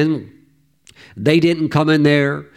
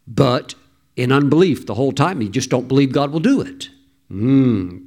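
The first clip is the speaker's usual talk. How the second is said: ordinary delivery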